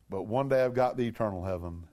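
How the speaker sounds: ordinary talk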